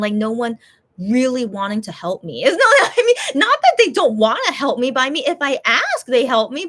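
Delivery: ordinary talk, nothing marked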